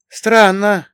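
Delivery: high, loud, stretched-out surprise; puzzled drawl. A narrator's reading. Bogdan suddenly noticing something odd on the path, puzzled drawl. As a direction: draw the word out, high and puzzled. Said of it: "Богдан неожиданно протянул".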